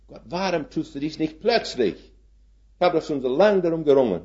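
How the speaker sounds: a believer urging God to hurry; impatient prayer to God, quickening. Retold or imitated.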